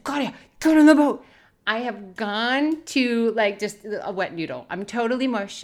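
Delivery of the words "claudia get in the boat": quick, loud gravelly command; deep grovelly voice. Retold or imitated. Husband urging his wife out of the water, gravelly shout. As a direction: bark it fast, gruff and urgent. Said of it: "this deep, grovelly voice".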